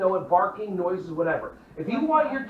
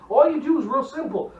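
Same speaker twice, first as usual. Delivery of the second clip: usual speaking voice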